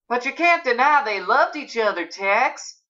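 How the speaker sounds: smooth it over with cheer; brightly. A narrator's reading. Aunt jumps in to ease the tension, brightly. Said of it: "she says brightly".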